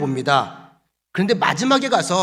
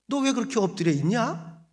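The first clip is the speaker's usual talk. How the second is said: wry humour; 5 semitones higher, 5 dB quieter than usual. Acted. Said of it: "굉장히 유머러스하게 말씀을 던집니다".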